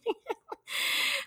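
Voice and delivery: a high, soft laugh; a short, quiet laugh. Real, not acted. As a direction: laugh it off gently. Laughs after "no es tan fácil.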